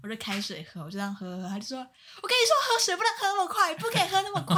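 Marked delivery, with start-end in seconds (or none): 2.2-4.6 much higher, louder, shrill lecture; high-pitched scolding. Retold or imitated. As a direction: scold high and loud about drinking fast. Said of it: "很高亢的咆哮". (高亢地咆哮训人)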